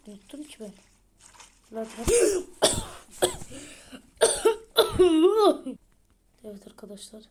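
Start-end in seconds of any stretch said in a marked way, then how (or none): 2.1-5.8 loud, higher burst of laughter; loud helpless laughing fit. Real, not acted. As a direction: crack up loudly.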